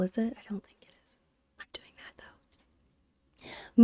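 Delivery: very soft, voiceless whisper; hushed murmur to oneself; whispered aside. Real, not acted. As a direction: drop to a near-silent whisper, as if confiding. Doubting aside about legality of downloading.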